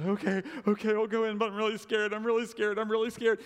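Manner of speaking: hammed-up frightened whimper; mock scared. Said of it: "she goes".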